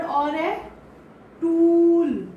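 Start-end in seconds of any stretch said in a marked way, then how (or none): 1.4-2.3 टूल with a loud long oo; long stressed oo. Shown as a demonstration. Teacher stressing the long oo in tool. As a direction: press the oo in टूल. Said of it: "ऊ पे हम stress डालते हैं".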